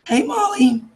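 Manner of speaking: ordinary talk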